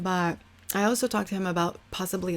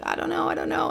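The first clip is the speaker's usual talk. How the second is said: hesitant; 9 semitones higher, 3 dB louder than usual. Retold or imitated. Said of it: "I was like".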